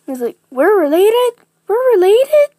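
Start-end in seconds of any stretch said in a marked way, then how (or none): 0.5-1.4 high, loud squeal of surprise; acted loud, high-pitched shock; astonished question. Character learns they are family. 1.7-2.5 very high, very loud squeal; top-of-the-range acted shock; escalated astonishment. Repeats the shock, pitched higher.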